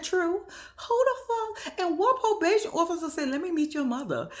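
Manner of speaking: high, excited disbelief; incredulous agreement. Retold or imitated. Vlogger agreeing with Louis, high excited disbelief. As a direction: squeal it in disbelief. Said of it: "I said".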